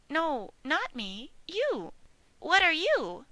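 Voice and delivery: high little piglet voice; bright squeaky young pig. Acted. Piglet answering the old sheep, high piglet voice.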